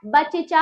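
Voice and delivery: बच्चा in three beats, ba-che-chaa; wrong full च. Shown as a demonstration. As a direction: break बच्चा with a vowel after first च. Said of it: "पूरा चा कर देते तो क्या होता?".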